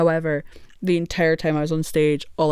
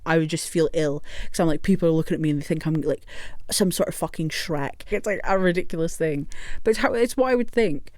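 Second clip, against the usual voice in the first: ordinary talk, nothing marked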